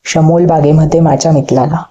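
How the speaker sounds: usual speaking voice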